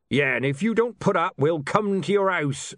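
high, rough threatening beggar voice. A narrator's reading.

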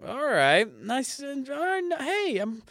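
hammed-up high chummy New Yorker; won-over New Yorker. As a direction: play the local warming up all at once. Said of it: "they're like".

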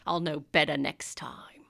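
a quick sheepish whisper; whispered aside. Acted. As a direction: whisper it to yourself, embarrassed. Embarrassed aside after smoky entrance.

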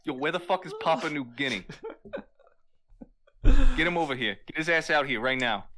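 loud wise-guy order; mock tough-guy demand. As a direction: boss them around like a hammed-up mobster. Acting Italian guy demanding Papa.

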